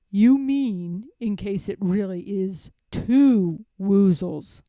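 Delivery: slow, lightly voiced bear; a slow, thoughtful Pooh. A narrator's reading. Pooh answers Piglet, slow and ponderous.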